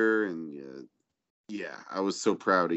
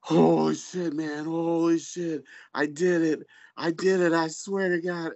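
higher, giddy retelling of his triumph; excited friend; 7 semitones higher than usual. Retold or imitated. Friend calling from a convertible, giddy and thrilled. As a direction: sound thrilled, a bit breathless. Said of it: "he's just like".

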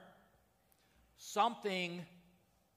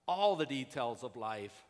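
ordinary talk

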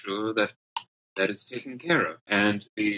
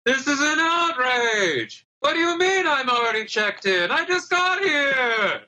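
outraged shout; 5 dB louder than usual. Acted. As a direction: bellow the outrage from outside. Outraged guest yelling outside.

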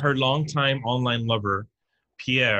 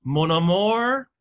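mock French; 9 semitones higher than usual. [name] cooing to Pierre in mock French. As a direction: stretch out "Mon amour" sweetly, higher. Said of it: "she speaks with a Southern accent".

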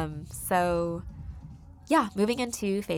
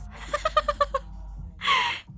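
burst of laughter. Real, not acted. Telling listeners the Target story, bursting out laughing. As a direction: crack up at the dad's answer. Laughing at daughter's sitcom reply.

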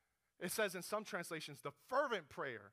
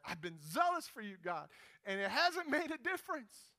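zealous complaint, second time; 4 semitones higher, 3 dB louder than usual. Retold or imitated. Elijah tells God a second time, protesting more insistently. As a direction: protest rising higher to the end. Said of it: "after the second time Elijah said that".

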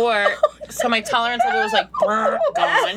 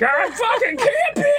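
put-on high, slow drunken yell; drunken shout; 12 semitones higher than usual. Drunk mom shouting at the campsite, slow high yell. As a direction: bellow it like a drunk sailor. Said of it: "cursing like a sailor".

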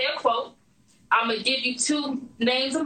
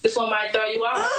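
ordinary talk, nothing marked

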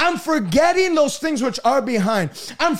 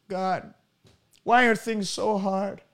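hushed, much softer and lower, plaintive; mock defeated prayer; 7 semitones lower, 6 dB quieter, slower than usual. Preacher voicing the prayer David didn't pray, softly self-pitying. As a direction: sigh it out like someone giving up.

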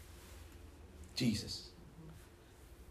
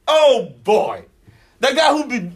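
ordinary delivery

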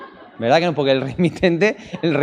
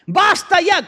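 mimicked high-pitched desperate yell; acted-out prophet's shrieked plea; shouting at God; slower than usual. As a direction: cry out in protest to heaven. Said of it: "empieza a gritarle a Dios".

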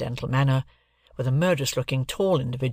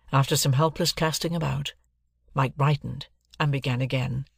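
ordinary delivery

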